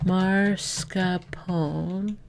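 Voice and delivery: Mascarpone drawn out slowly in three syllables, ending in -pone like "phone". Shown as a demonstration. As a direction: say Mascarpone very slowly and softly, silent final E. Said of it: "or mascarpone? Three syllables".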